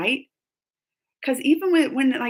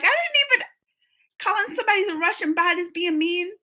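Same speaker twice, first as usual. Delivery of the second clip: very high-pitched mock protest; retold indignation; 9 semitones higher than usual. Retelling own reaction to a Facebook ban, indignant. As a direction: squeak out the retold objection.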